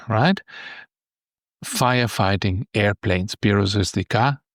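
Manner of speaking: ordinary talk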